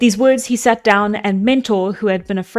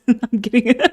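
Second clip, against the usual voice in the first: plain reading voice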